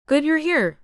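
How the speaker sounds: woman with a mild Jamaican lilt; slight Jamaican accent. A narrator's reading. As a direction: greet with a hint of a Jamaican accent. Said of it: "said in a slight Jamaican accent".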